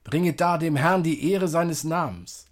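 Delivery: usual speaking voice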